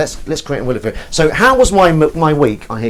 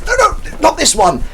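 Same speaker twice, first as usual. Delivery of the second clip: mock panic, much higher and louder; panicked; 5 dB louder than usual. Friend at karaoke hearing the wrong song, mock panic. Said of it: "the panic on his face".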